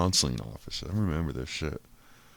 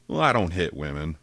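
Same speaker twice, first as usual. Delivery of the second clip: ordinary delivery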